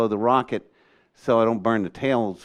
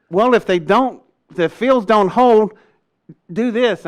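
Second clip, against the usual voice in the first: high, louder retold wisecrack; cheeky joke; 9 semitones higher, 6 dB louder than usual. Joking to the colonel about the fields, playful. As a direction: tease him with a grin in the voice.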